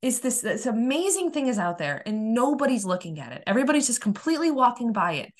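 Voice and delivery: high, exasperated wail of a question; retold upset. Upset that nobody looks at the amazing thing, exasperated. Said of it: "I was so upset".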